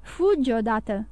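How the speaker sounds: plain reading voice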